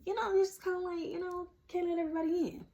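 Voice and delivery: a singer's voice for a joke. Retold or imitated.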